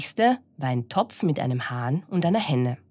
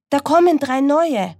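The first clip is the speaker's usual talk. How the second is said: high, loud rooster voice; crowing rooster; 11 semitones higher, 7 dB louder than usual. Acted. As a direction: announce it with a crow. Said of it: "krähte der Hahn".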